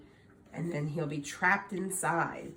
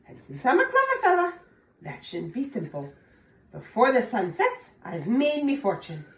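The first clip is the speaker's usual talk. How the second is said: boastful Tom; 6 semitones higher, 5 dB louder than usual. Acted.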